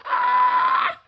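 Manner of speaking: shrill squealing mock yell; high mock shout. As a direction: screech it in disbelief. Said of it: "And Sundancer go like".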